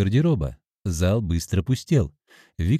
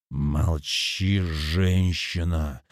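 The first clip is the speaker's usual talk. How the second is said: low, slow menace; angry command; 4 semitones lower, 3 dB quieter, much slower than usual. A narrator's reading. Man silences pleading girlfriend, low angry command. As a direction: order silence heavily, without shouting. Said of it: "Виктор зло взглянул".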